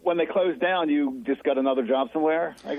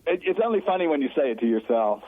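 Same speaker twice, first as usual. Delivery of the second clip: usual speaking voice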